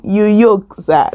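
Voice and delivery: "You yoke" with joke said as yoke, Akwa Ibom accent. Shown as a demonstration. Greeting Akwa Ibom viewers, playful Akwa Ibom accent. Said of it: "That's my Akwa Ibom accent".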